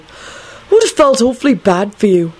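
a touch of earnest self-righteousness; mildly drugged sincerity; self-righteous. A narrator's reading. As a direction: insist earnestly, slightly righteous. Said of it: "Neville continued self-righteously".

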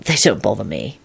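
low, soft, untroubled reply; calm. A narrator's reading. Lupin reassuring Elizabeth about the forest, low calm voice. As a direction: drop low and quiet, quite calm. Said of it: "Lupin replied calmly".

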